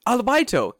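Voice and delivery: high, loud mimicry of the word; mocking repetition. Jordan mocking the teacher's word, high loud mimicry. Mocks word against Italian-sounding words.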